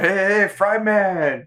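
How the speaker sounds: high, slow, sleazy buddy voice; Carl's voice. Retold or imitated. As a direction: drag out the greeting in a high, wheedling voice. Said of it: "Carl's like".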